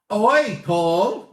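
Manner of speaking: a playful "oi", loud and high; mock telling-off. Host on a livestream mock-scolding a viewer for his comment, loud. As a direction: call out a cheeky viewer in mock outrage.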